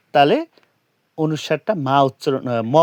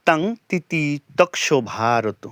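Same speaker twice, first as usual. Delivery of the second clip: slow, measured Sanskrit chant; verse recitation. Shown as a demonstration. Teacher opens the lesson by chanting the verse, slow and solemn. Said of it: "opening Gita verse recitation".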